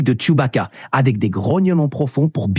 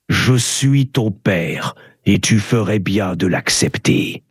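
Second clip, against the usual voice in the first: much lower, slow and weighty villain father; Darth Vader; 9 semitones lower than usual. Acted. Dark father answering his angry son's accusations, deep and imposing. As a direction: answer a defiant son in a deep, measured, commanding voice. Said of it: "mais tu es le père".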